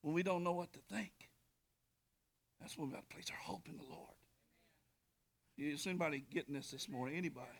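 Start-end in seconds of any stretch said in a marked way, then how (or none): 2.6-7.5 much softer, fast whisper; hushed urgent whisper. Real, not acted. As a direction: lean in and whisper it. Whispered point.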